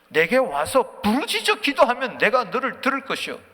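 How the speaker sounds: high, emphatic recitation; full-force preaching. Real, not acted. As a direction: declare the verse forcefully. Proclaims scripture at full force.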